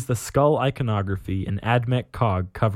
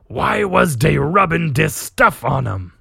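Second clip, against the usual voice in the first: puzzled orc, higher and louder; 4 semitones higher, 5 dB louder than usual. Acted. Orc captain inspecting a container, puzzled orc voice. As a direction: ask it as a baffled orc boss. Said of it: "you read an orc talking".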